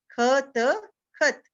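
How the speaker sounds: ख and त slow and apart, then खत joined; sounded out, then blended. Shown as a demonstration. Teacher recapping the second word for children, sounded out slowly. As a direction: stretch ख, त, then say खत whole. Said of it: "पीछे जो हमारी sound दे रहा है".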